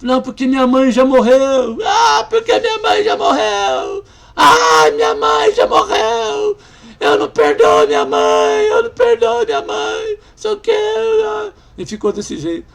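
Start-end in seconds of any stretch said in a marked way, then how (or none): 0.0-1.8 loud, rising distress; the woman's loud first protest; upset cry. Retold or imitated. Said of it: "quase começou a espernear". 1.9-4.0 much higher, loud, sobbing wail; a grieving woman's howl over her dead mother; wailing shout. Retold or imitated. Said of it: "quase começou a espernear". 4.4-11.5 much higher, loud wailing sobs; the woman screams that she won't forgive her mother; sobbing shout. Retold or imitated. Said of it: "quase começou a espernear".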